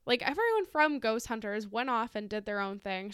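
normal talking voice

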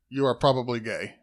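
usual speaking voice

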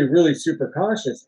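usual speaking voice

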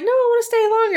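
very high mock child's voice; whining kid. Kid begging mother to stay at the beach, high child voice. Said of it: "And the kid's like".

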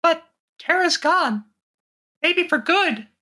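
high and slow, downcast little hero; high voice, slowed by sorrow; sad high cartoon mouse voice. Same mouse character, sadder.

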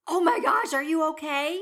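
high, loud panicked concern; alarmed gasp. Retold or imitated. Checking on her injured partner, high alarmed cry. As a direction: gasp it out to someone who just fell. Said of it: "and I'm like".

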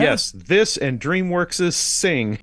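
slow, loud, high mock earnestness; deadpan sarcasm. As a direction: sell the joke straight. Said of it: "See what I did there?".

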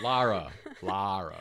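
Lara said slowly as LAH-ruh; LAH-ruh, open ah, trying hard; careful Lara. Shown as a demonstration. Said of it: "without my Wisconsin accent".

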